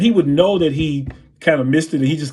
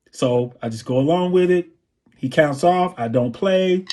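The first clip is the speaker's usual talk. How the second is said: normal talking voice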